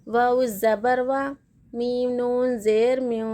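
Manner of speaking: slow, measured chant with long-held vowels; Quran spelling recitation. Shown as a demonstration. Teacher models Quran reading for learners, slow drawn-out recitation. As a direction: spell each letter and mark, then draw out the joined syllable.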